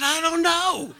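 high, loud comic wail; hammed-up bewildered outburst; helpless wail. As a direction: cry it out helplessly, half laughing. Retold helpless cry while weeping.

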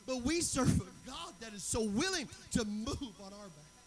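loud, high, driving preacher delivery; booming pulpit exhortation; full-force preaching. Real, not acted. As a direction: preach it out with rising force, leaning on key words.

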